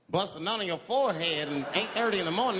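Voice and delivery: ordinary talk, nothing marked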